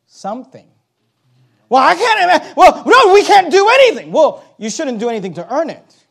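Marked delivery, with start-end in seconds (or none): 1.7-4.1 higher, louder protesting voice; mock objector. Preacher voicing a doubting listener, mock protest. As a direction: toss up the listener's objection in a hammed-up whine.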